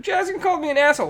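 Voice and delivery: put-on high, loud, quick tattling kid; Ollie. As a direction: imitate an aggrieved little boy. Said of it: "And he's like".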